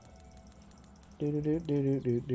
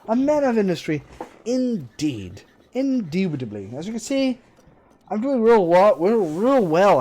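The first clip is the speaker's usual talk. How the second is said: mock posh gentleman; 7 semitones higher, 8 dB louder than usual. Posh sarcastic gloating while broke.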